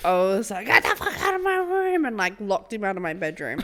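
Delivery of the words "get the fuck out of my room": high-pitched retold fury; furious outburst. Owner orders dog out of bedroom, furious.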